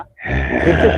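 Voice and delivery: very low ghostly echo. Acted. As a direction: echo it like a deep spirit. (गहरी भुतहा आवाज़ में)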